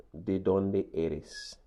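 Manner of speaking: ¿De dónde eres? said slowly, each syllable clear; slow Spanish model. Shown as a demonstration. Teacher to class, slow clear model pronunciation. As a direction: spell out ¿De dónde eres? for learners. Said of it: "move on to this awesome question".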